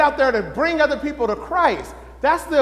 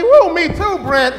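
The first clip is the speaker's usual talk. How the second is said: excited reply; 5 semitones higher, 6 dB louder, slower than usual. Acted. Voicing fellow member's reply.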